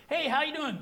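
breezy cliche greeting. Retold or imitated. Greeting a passerby, lightly voiced breezy hello. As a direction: toss off a routine hello. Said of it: "cliche conversation".

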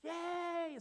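high, bright parental cheer; cheering as the baby tumbles; mock cheer. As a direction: cheer to make the fall seem fine. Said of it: "you're like going".

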